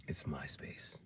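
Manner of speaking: very soft low whisper. Real, not acted. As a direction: whisper it like a secret.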